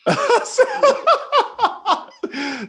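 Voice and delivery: long, high-pitched burst of laughter; hearty laugh. Real, not acted. Host cracking up at the guest's South Denver joke, hearty laugh.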